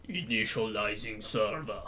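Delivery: hammed-up game server announcement; mock system voice. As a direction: intone it like a hardcore computer. Mock computer announcing server start.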